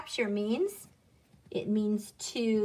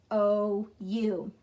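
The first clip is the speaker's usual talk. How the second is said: ordinary talk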